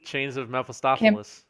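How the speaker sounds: Mephistopheles said wrong on purpose, ending in "-us" instead of "-eez"; deliberate mispronunciation. Shown as a demonstration. Co-caster echoing the card name, deliberately mispronouncing Mephistopheles with an "-us" ending. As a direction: garble the end of Mephistopheles into something like "meffa-STAH-fa-mus". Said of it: "I purposely said that wrong".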